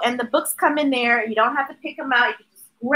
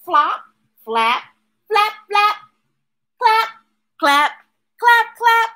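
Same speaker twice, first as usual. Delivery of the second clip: playful sound words; 8 semitones higher than usual. Acted. Sound words in picture book.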